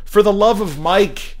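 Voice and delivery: high, slow exasperated oath; exasperated exclamation. A narrator's reading. Father swearing mildly, high drawn-out exasperation.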